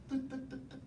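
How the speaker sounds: imitating the pastor tapping his Bible; tapping noise. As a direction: mimic tapping on a book with the voice.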